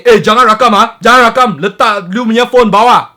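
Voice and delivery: loud, high threatening shout. Retold or imitated. Taxi driver threatening the vlogger not to film, loud. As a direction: bark at him to stop filming. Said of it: "started ramping at me and said".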